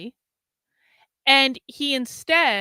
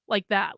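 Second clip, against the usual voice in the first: a long, soft, drained sigh; exhausted deflating sigh; 3 semitones lower, 3 dB quieter than usual. Retold or imitated. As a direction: let the whole body sag out on the breath. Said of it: "my whole body's like".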